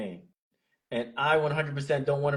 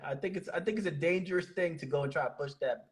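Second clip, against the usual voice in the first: ordinary talk, nothing marked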